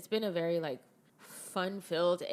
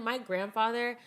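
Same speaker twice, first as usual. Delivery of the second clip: normal talking voice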